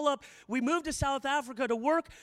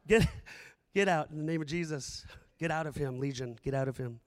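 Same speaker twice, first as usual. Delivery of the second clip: low commanding exorcism; 10 semitones lower, faster than usual. Acted. Acted exorcism of Legion.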